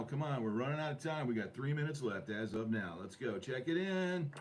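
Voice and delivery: ordinary talk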